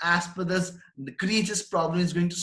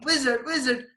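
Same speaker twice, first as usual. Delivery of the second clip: mild retold shout; 8 semitones higher than usual. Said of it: "she's shouting".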